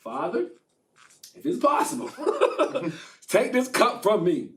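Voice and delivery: high strained pleading with laughter; beg, voice pushed up high; mock desperate prayer to Father. Jesus praying in Gethsemane.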